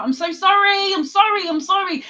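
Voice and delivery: high, loud repeated apology; effusive apology. Real, not acted. Apologising to Sherry for making her cry, high effusive apology.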